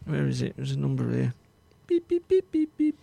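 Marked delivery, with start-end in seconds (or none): 1.9-3.0 mock dial tones. Dialling a phone number.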